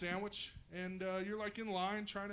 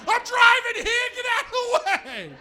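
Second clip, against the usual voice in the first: East Coast yell; 10 dB louder than usual. Retold or imitated. East Coast driver yelling.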